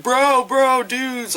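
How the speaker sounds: high mock bro exclamations; bro speak. Mocking the annoying segment dialogue, high bro speak. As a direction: imitate excitable dudes calling each other. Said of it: "that kinda speak".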